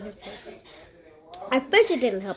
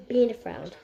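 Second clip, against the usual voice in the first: usual speaking voice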